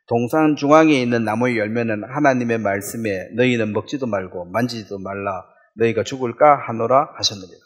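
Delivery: low, measured Bible reading; recited verse, dropping low and soft; scripture reading. Acted. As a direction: read the verse aloud, pitched well down.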